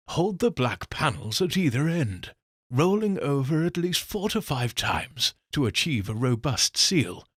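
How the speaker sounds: normal talking voice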